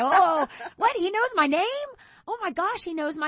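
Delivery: high-pitched, laughing disbelief; giddy amazement. Retold or imitated. Schoolgirl reacting to the cute boy knowing her name, giddy. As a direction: gush like a starstruck schoolgirl. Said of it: "trying to act cool".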